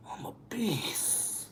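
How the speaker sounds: breathy whispered confession. Real, not acted. Admitting to the hosts what she is like drunk, whispered. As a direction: drop to a secret whisper.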